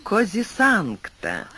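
ordinary delivery, nothing marked

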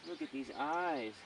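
ordinary talk

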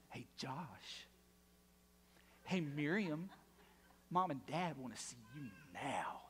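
low, hushed, near-whispered message; ominous quiet summons. Retold or imitated. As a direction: relay the parents' order with relished menace. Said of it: "That's how they said it too".

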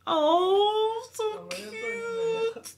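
high, loud ecstatic yeses; so excited. Real, not acted. Fan overwhelmed by the kiss scene, ecstatic squealing. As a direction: squeal it like you're dying of joy. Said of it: "I'm so excited now. I'm dying".

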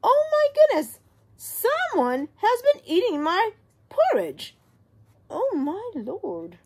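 very high, loud squeal of a shocked mother bear; Mama Bear. Acted. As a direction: shriek it high like an alarmed mama. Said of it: "And the Mama Bear goes".

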